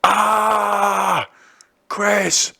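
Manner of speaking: high, loud pained yell for a friend; reliving the scream, half laughing; retold scream. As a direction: yell the mate's name in pain. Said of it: "screamed to the top of my fucking lungs".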